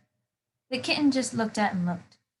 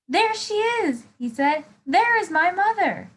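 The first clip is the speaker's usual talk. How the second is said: high, delighted cry of discovery; high and overjoyed; excited bird; 9 semitones higher, 3 dB louder than usual. Acted. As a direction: squeal it like a chick who found mom. Bird finds his mother.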